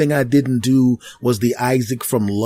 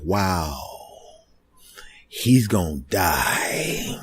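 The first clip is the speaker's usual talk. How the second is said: awed hush; 10 semitones lower, 6 dB quieter, much slower than usual. Retold or imitated. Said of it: "they're looking at me like".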